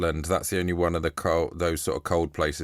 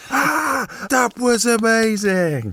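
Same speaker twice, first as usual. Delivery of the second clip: very high, slow excited squeal; hammed-up ecstatic crowd cheer, drawn out; cheering audience; 4 dB louder, much slower than usual. Pretending to be the applauding gig crowd, high cheer.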